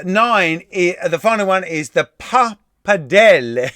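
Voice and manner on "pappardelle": "pappardelle" broken up in a showy Italian accent; mock Italian accent. Shown as a demonstration. Quiz host who is not Italian, attempting an Italian accent. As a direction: try an Italian accent, leaning on the "dell". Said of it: "I'm not Italian anyway".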